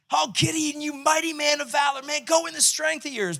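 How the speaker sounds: high, ringing proclamation; calling his identity. Pretend. Angel calling Gideon's identity, high and ringing. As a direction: declare who he is. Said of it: "he is calling who Gideon is".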